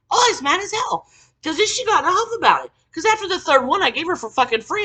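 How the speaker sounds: loud, high, worked-up venting; loud angry venting; furious rant. Real, not acted. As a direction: boil over with outrage. Still raging about her.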